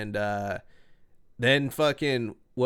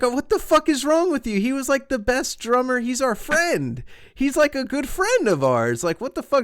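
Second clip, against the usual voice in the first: high, loud incredulous outburst, re-living it; retold outrage; 13 semitones higher, 6 dB louder than usual. To bandmates over firing the drummer, high loud outraged disbelief. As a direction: shout in disbelief that they kicked out his friend.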